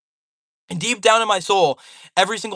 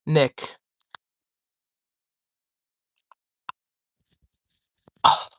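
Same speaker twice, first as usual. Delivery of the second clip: phone held in teeth; 2 semitones lower than usual. Real, not acted. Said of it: "I had to put my phone in my mouth".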